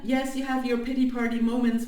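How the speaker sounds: ordinary talk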